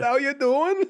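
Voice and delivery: high mock Italian-American "How you doing?"; mock Italian greeting. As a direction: echo it as a cheesy Italian charmer. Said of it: "God, that's so Italian".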